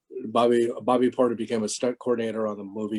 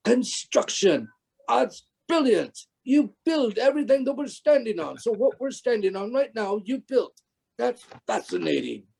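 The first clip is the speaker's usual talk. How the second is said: Warwick Davis; 10 semitones higher than usual. Retold or imitated. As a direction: marvel like a boy amazed by everything. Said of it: "that's Warwick".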